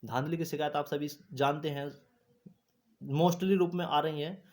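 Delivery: ordinary delivery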